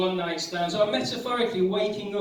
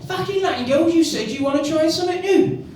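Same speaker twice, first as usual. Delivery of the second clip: much higher, louder shouting inner voice; [name] shouting; 10 semitones higher, 7 dB louder than usual. Acted. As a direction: bark at yourself like a London lad.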